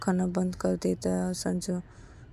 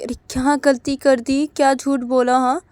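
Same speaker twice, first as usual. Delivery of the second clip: worried questioning; 5 semitones higher, 10 dB louder than usual. Retold or imitated. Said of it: "Sanju की mummy बोलती कि".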